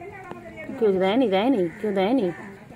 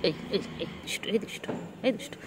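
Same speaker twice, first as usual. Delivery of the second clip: whispered teasing; 9 dB quieter than usual. Real, not acted. Whispered scolding to cat.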